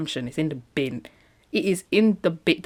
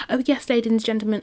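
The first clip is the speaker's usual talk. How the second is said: higher, brisk British announcement voice; mock British Jada; 7 semitones higher, faster than usual. Imagining Jada addressing the public, mock British accent. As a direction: put on a British accent, pitched up. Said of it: "I don't know why Jada's not British".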